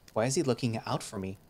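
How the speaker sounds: plain reading voice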